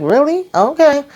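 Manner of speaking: high-pitched disbelieving reaction; high, sceptical little aside; incredulous. Real, not acted. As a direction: pitch it way up, as if doubting it.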